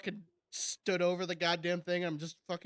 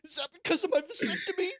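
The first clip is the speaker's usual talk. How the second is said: laughing mock panic; 7 semitones higher, 7 dB louder than usual. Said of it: "I'm like".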